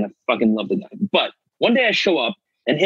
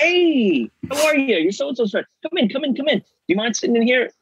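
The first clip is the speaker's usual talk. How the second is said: much higher, gushing welcome at the door; Tony Shalhoub greeting; 11 semitones higher than usual. Retold or imitated. Host welcoming a nervous actor into his home, high and eager. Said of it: "Tony Shalhoub answers the door".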